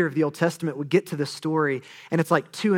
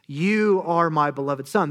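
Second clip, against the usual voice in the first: "You" stressed with a rising lift. Shown as a demonstration. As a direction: linger on "You", slowly. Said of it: "Who is God the Father talking directly to?".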